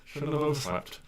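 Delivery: flustered latecomer. Acted. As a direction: fret to yourself as you rush past. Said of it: "You see the funny one going".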